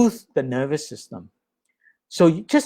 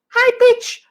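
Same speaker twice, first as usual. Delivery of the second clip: very high, louder demo voice; high pitch; 5 dB louder than usual. Shown as a demonstration. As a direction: leap way up in pitch.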